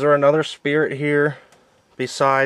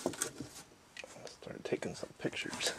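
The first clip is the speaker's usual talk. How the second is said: whispering; 22 dB quieter, much slower than usual. Real, not acted. Quiet photo-taking during ghost hunt.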